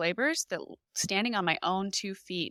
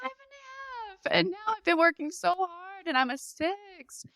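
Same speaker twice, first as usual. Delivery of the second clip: half-crying; near tears; 11 semitones higher than usual. Retold or imitated. Mother in labour telling of her progress, near tears. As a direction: voice cracking high with retold despair.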